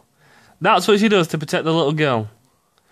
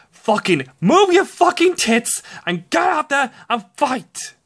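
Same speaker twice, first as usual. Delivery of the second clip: higher-pitched angry rant at the character; genuinely mad, pitched-up tirade; real anger; 7 semitones higher than usual. Real, not acted. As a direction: vent real frustration at the screen. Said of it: "I'm getting mad at this thing".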